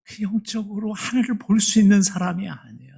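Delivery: usual speaking voice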